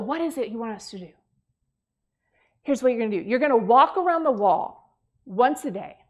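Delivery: normal talking voice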